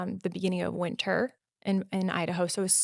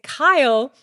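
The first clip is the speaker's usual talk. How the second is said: surprised; 6 semitones higher, 11 dB louder than usual. Retold or imitated. Friend realizes rescuer arrived.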